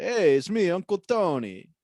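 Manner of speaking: high, loud Italian wiseguy intro; Italian mobster accent. Shown as a demonstration. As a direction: announce yourself like a cartoon mobster. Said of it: "establish the accent".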